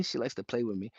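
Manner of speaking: ordinary talk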